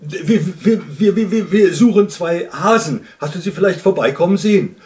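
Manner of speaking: loud, high nervous stutter; flustered shout across a distance; calling back. A narrator's reading. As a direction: stammer loudly, then rush the question. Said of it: "rief der Luchs zurück".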